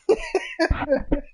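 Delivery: high squeal of a laugh, real amusement. Real, not acted. Teasing a friend on the phone, shrieking laugh. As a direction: burst into a shrieking giggle.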